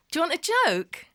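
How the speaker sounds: high-pitched coaxing question; retold bright offer. Daughter offering her agitated dad a joke, high coaxing question. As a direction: lift the question right up.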